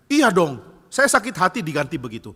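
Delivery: loud huffy admission; acted indignant retort; angry, hurt. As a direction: fire back with wounded pride. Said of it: "Kamu marah ya?".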